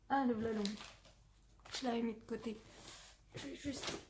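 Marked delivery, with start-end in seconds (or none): 3.4-4.0 pained groans while stretching legs; sore muscles. Real, not acted. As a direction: wince through the aches. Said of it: "J'ai mal aux jambes".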